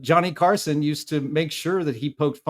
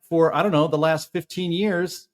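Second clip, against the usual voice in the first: ordinary delivery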